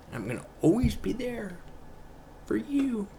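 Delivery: high, airy ghost voice; ghostly voice. Pretend. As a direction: call out like a ghost from the grave. Joking voice from the grave.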